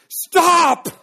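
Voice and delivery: one explosive, high-pitched "Stop!"; emphatic shout. Acted. Samuel interrupting Saul's lie, emphatic shout. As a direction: cut them off with a full-force shout. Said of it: "He shouted it".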